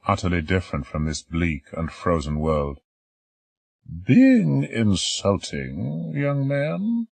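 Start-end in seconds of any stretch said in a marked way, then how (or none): 3.9-7.1 put-on very slow, high querying old voice; mock-offended question; teasing old lady. Old lady querying the doctor, slow and teasing. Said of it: "she queried".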